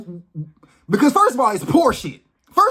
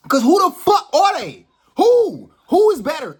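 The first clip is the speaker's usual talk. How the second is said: yelling, voice cracking high on who; furious shout; 6 semitones higher, 6 dB louder than usual. Real, not acted. Ranting at people who treat him as lesser, furious shout. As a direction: shout it at whoever talked down to you. Heated rant about being talked down to.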